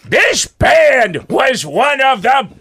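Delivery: high, loud, slow squawking shout; Gilbert Gottfried. Acted. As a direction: yell every word like the cranky comic. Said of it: "Gilbert Gottfried".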